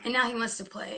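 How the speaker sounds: ordinary delivery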